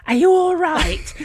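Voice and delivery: put-on high, slow northern Lincolnshire accent; crazy northern accent. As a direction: stretch it out in a broad Lincolnshire accent. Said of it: "Again in that crazy accent".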